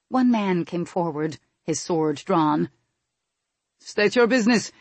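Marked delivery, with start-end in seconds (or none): 3.8-4.7 louder, raised challenge; a guard's firm, loud demand. A narrator's reading. Guard challenging the visitors at the gate, demanding. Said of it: "he demanded".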